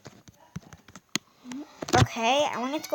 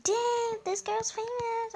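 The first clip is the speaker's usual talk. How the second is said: impressed fans; 10 semitones higher, slower than usual. Retold or imitated. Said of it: "everyone's like".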